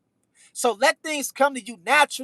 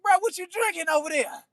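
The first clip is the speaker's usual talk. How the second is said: higher, teasing voice of friends asking; higher mock-nosy bruh voice; mock incredulous friends; 6 semitones higher than usual. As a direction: play the suspicious buddy poking fun. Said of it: "y'all be like".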